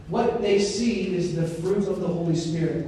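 usual speaking voice

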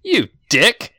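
loud, high, slow insult; angry taunt. Acted. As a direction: yell it to pick a fight. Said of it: "turns to look at the guy with the sword and goes".

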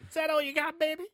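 high-pitched, teasing taunt; taunting bong. Acted. The bong taunting Chong as he hammers, high mocking voice. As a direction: goad him in a put-on high voice. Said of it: "the bong just taunts Chong".

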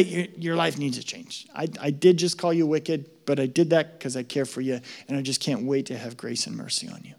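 soft, gentle caring voice; tender God. Retold or imitated. God gently correcting a struggler, soft and tender. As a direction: speak it softly like a loving father. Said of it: "He might say".

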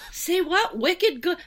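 put-on a light Boston accent on wicked good. The niece from Boston indulges the office, in a Boston accent. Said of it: "I'm like".

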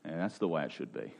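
quick, hushed smug aside; mock self-satisfied aside, fast and soft; smug inner voice. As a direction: mutter it like a man's secret smug thought. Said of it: "we're just going".

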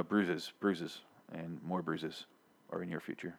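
low, very soft, slow reading; grim deadpan fortune. Acted. Reading partner's fortune cookie, grim and hushed. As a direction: let it sink quietly into doom.

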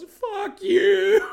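high, loud cartoonish yell through laughter; hammed-up shout, cracking up; laughing mock yell. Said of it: "and he laughs yells".

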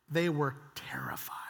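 dramatic stage whisper; hushed emphasis. A narrator's reading. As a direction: drop to a whisper for effect. Preacher dramatizing the disciples' fear.

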